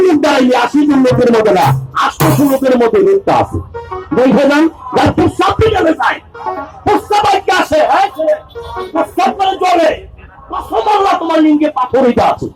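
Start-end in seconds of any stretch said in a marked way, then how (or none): 4.2-12.5 high, shouting sales pitch. Real, not acted. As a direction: bellow it at the crowd. Street hawker pitching remedy to crowd.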